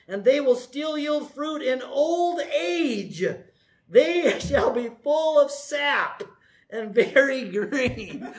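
slow, weighty reading of the psalm; scripture reading. Acted. Preacher reading Psalm ninety-two to the congregation, slow and weighty. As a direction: let each line of the verse land slowly.

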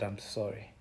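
very soft, whispered-low apology; a contrite near whisper; hushed repentance. Acted. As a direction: barely breathe it out. Job repenting on knees.

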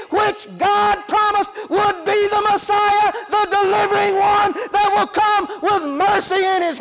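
high, driving full-force preaching. Real, not acted. Preacher proclaiming the promised Messiah, full-force preaching.

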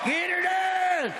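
high, slow, stretched-out catchphrase; high catchphrase holler. Acted. Comedian rousing the crowd, high drawn-out catchphrase. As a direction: holler it high and hold the last word.

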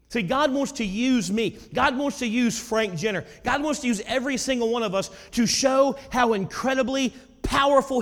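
high, loud, driving pulpit preaching; preaching at full force. Acted. The pastor proclaims God's power to the congregation, loud preaching. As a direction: build it up and proclaim it.

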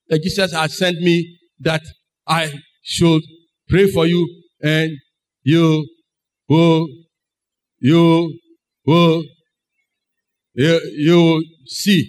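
halting, with long pauses between words; hesitant messenger. Acted. Ananias tells Saul why he was sent, haltingly. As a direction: deliver it word by word, reluctant to finish.

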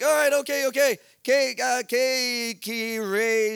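loud, high placating then stumbling gringo Spanish; hammed-up gringo grasping for Spanish words; dumb gringo panicking. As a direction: stammer out clueless American-accented Spanish. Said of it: "play the dumbest gringo ever".

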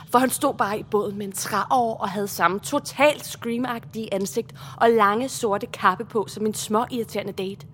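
ordinary talk, nothing marked

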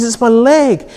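put-on high, earnest little girl; young daughter announcing she's been bitten; daughter's voice. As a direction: play the stung eight-year-old calling to Dad.

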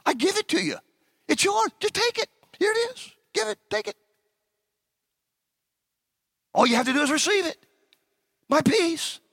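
high, lightly voiced offering; voicing Jesus. Preacher voicing Jesus offering his peace, light high pleading voice. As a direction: hand it to them eagerly. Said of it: "he said".

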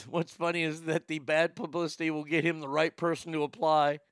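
normal talking voice

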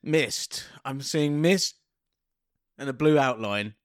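low, halting, as if seeing a vision; hammed-up mystic scene-setting; mock visionary. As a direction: intone each image slowly, with long pauses. Improvising a visionary cold open.

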